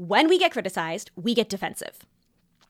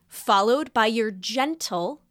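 gentle slowed and leaned on; stress on gentle; slower than usual. Shown as a demonstration. Advising a friend on feedback, slow stress on "gentle". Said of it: "emphasis on the word gentle".

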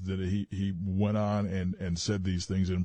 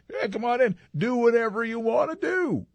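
high, mock-inviting voice; imagined welcoming bosses; 12 semitones higher than usual. Co-host mocking network bosses indulging the anchor, high mock-welcoming voice. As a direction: put on a much higher, over-accommodating voice. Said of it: "did he just think that they were going to".

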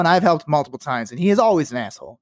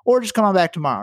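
usual speaking voice

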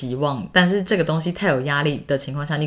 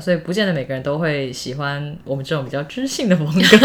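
ordinary talk, nothing marked

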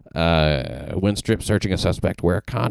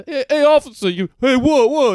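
high, loud New York street holler; mock New Yorker calling out to a cop; exaggerated New York accent, pitched way up; 6 dB louder than usual. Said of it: "It's just New Yorker".

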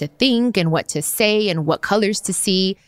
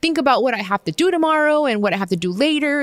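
higher-pitched whiny complaining friend; mock whiny friend; 8 semitones higher than usual. Imitating a friend complaining to [name], higher whiny voice. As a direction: play the friend who says she can't. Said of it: "They're like".

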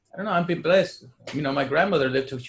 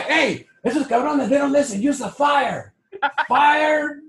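high, loud bellowed order; mock parent shout; 12 semitones higher, 7 dB louder, slower than usual. Parent yells about disobedient kids, high and loud. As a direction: yell like a furious old-school parent.

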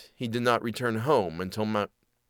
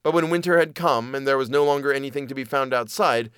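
plain reading voice

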